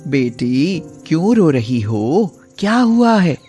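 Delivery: put-on a granny checking on a crying girl; old woman voice. (बूढ़ी अम्मा की आवाज़)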